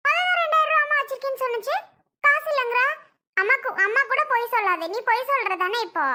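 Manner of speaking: put-on high-pitched pleading little girl; whiny child. Little girl pestering her mother for a bonda, high whiny plea. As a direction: whine at mum like a kid denied a treat.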